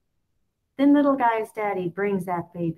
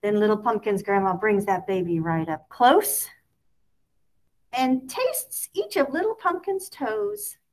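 usual speaking voice

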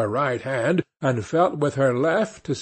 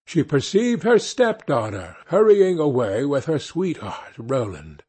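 plain reading voice

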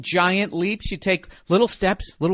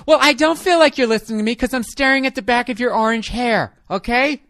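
nagging wife; 7 semitones higher, 5 dB louder than usual. Acted. Girlfriend continues nagging.

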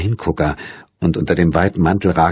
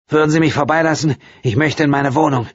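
curt request; 9 semitones higher than usual. A narrator's reading. Woman wants to pass.

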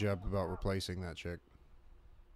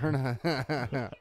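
louder, higher, through laughter; words breaking into a laugh; real laughing; 5 semitones higher, 4 dB louder, slower than usual. Real, not acted. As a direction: say it while cracking up. Laughing at the land-based remark.

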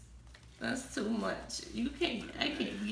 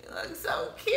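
crying while higher; real tears of gratitude; tearful, touched compliment; teary; 7 semitones higher, 3 dB louder than usual. Real, not acted. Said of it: "let me wipe my tears".

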